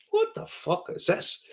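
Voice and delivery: hushed, drawn-out astonishment; high, slow mock amazement; imitated awed disbelief. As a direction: play a visitor stunned on walking in, slow and soft. Visitors' awed reaction, acted out.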